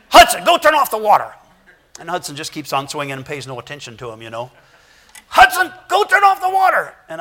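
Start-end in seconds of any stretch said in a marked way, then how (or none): 0.1-1.3 put-on high loud child giving orders; bossy little brother. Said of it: "he always speaks to the older brother this way". 5.3-6.9 put-on very high, loud kid voice; bossy child yell. Child repeats order louder.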